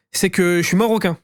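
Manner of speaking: normal talking voice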